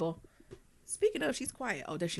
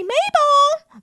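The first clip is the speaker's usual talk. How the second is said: much higher, louder sing-song call of the name; 4 dB louder than usual. Retold or imitated.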